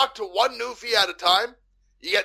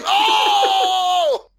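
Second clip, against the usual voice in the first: long, high, loud "oh"; mock climactic cry; 5 dB louder than usual. Joker demonstrates a Canadian O-face, mock climactic cry. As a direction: belt out a high, drawn-out mock "oh".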